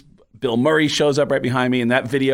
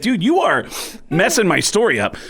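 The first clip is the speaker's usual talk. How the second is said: exasperated retort; 8 semitones higher than usual. Retold or imitated. Retells complaint to the drummer.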